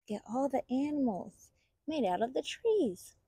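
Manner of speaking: ordinary delivery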